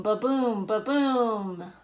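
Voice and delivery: usual speaking voice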